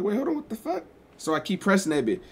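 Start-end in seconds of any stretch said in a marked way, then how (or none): 0.0-0.8 high, breathy-sounding disbelief; shocked. Retold or imitated. Vlogger retells his car dying, high startled disbelief. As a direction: squeak out the shock.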